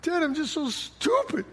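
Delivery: mock-sobbing child, softly upset; crying little kid. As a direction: play a small upset kid confiding in Dad. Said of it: "she's crying one day as a little kid".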